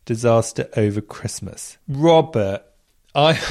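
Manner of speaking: ordinary delivery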